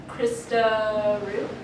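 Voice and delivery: ordinary talk, nothing marked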